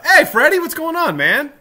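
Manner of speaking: loud, high-pitched hello; suddenly spot a friend and call out; excited shout-out. Real, not acted. Interrupts himself to greet a viewer.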